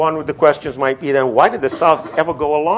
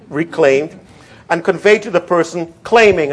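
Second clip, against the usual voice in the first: raised reading voice landing on "claiming"; stress on claiming; 5 semitones higher, 4 dB louder than usual. Shown as a demonstration. Lecturer quoting the clause to class, emphatic on "claiming". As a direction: read firmly up to a punched "claiming". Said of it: "I want to emphasize the word claiming".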